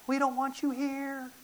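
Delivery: high, soft, slow drawn-out sneer; mock a crowd sending him away, stretching the vowels; rejecting crowd. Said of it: "No, they said".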